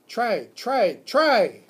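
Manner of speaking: very high and loud, building squeaks; excited squeaking. Acted. Hamster cheers the teacher on, shrill-loud squeaking. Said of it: "I squeaked".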